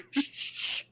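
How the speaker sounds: repeated unvoiced hushes; a string of urgent shh sounds; emphatic inner shushing. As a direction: shush hard, as if inside your own head. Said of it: "really, really loud, as loud as I can shh in my own mind".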